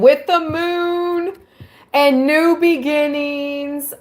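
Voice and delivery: light up as the text hits the moon; excited reading. Real, not acted. Reading the tarot meaning to viewers, rising excitement.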